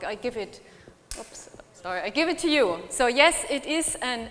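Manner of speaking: ordinary talk, nothing marked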